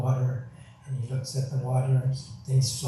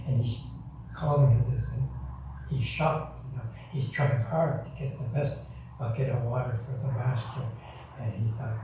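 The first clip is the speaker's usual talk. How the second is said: ordinary talk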